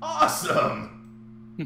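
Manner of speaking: slow, high sarcastic cheer; mock thrilled shout; sarcastic awesome. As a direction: fake delight at bad news. Sarcastic reply to negative score.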